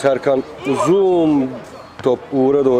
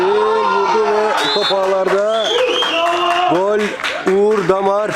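high-pitched goal scream, stretched out; screaming football commentator; 9 semitones higher, slower than usual. Real, not acted. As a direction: roar the goal at the top of your voice. Goal scored, commentator erupts. (çığlık atan futbol spikeri)

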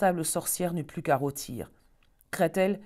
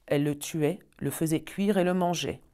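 plain reading voice

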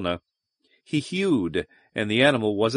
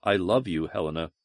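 quiet and tender; soft murmur; 6 semitones lower, 5 dB quieter than usual. A narrator's reading. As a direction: murmur it softly. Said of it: "he murmured".

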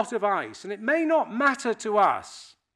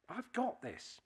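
"I've got this" said again much softer, almost under his breath; quiet repeat to himself; 14 dB quieter than usual. Retold or imitated. Reassuring himself on the ice, very quietly. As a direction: murmur it to yourself, convincing yourself.

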